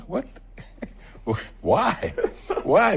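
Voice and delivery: high, laughing disbelief; high-pitched laughing what and why; incredulous. Real, not acted. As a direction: react in amused disbelief. Incredulous reaction to accent story.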